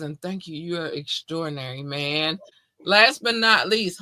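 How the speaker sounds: ordinary talk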